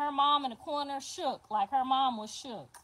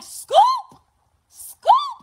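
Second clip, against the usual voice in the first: very high, loud rising Scoop; shouted gang call; 13 dB louder than usual. Retold or imitated. New mother shouting the gang call after birth. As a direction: yell it like a rallying cry. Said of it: "She was like".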